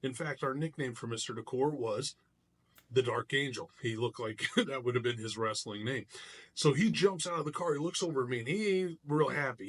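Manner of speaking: ordinary talk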